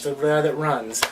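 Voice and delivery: ordinary talk, nothing marked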